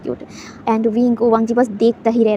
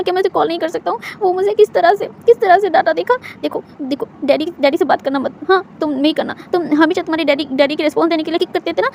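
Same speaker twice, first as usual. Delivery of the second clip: raised, higher voice full of wounded grievance; hurt, sulking complaint; 6 semitones higher, 4 dB louder than usual. A narrator's reading. Pregnant Wing sulkily complains to his unborn baby about Wangji. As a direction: pour out hurt to the baby in the belly.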